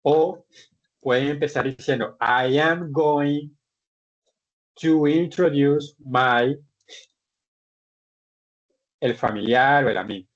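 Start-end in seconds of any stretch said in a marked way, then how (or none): 2.2-6.6 slow, spaced-out English for learners; slow model phrase. Shown as a demonstration. Teacher showing students how to start introducing a relative, slow modelling. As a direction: draw out each word so students can follow.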